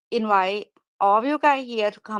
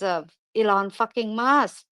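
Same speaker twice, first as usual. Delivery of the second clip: ordinary talk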